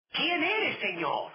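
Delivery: high, startled question that falls away. Acted. Saul, thrown to the ground, asks the voice, startled. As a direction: ask it as a man struck down.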